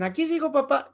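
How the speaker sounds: a hint of a small son calling dad; child's voice. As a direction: sing it out like a kid checking in. Child checking in again.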